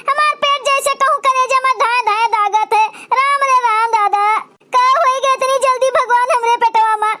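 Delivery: moaning village mother doubled over in pain; hammed-up stomachache lament; stomach pain groans. Stepmother writhing with stomach pain.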